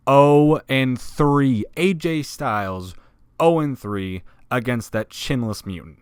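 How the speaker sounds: "Oh and three" drawn out slowly and loud; slow, punched repeat of "Oh and three"; incredulous emphasis. Real, not acted. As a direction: hammer "Oh and three" in disbelief. Incredulous repeat of losing record.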